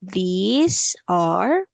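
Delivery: very slow, stretched words with rising tones; teacher's drawn-out repeat-after-me delivery; slow model sentence. Shown as a demonstration. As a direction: stretch each word for a learner. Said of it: "Okay, one more time".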